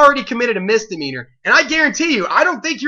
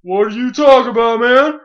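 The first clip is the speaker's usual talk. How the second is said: higher, louder put-on voice of a defensive teen; mock innocent kid; 4 semitones higher, 5 dB louder than usual. Teen caught shoplifting, denying it to the clerk, hammed-up whine. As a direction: play dumb, protest way too hard. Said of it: "he tries to play like".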